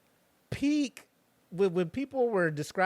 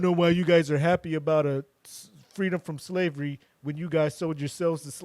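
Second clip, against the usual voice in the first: loud mock indignation; mock-mad commenter; 4 dB louder than usual. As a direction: imitate an outraged online commenter. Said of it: "People mad".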